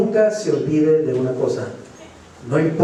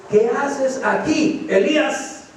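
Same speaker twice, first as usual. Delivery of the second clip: God's question, higher and slow; 8 semitones higher, slower than usual. Acted. Preacher voicing God asking Elijah why he is there. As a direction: ask it slowly from on high, as God. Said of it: "aquí vino a él una voz diciendo".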